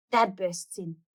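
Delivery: usual speaking voice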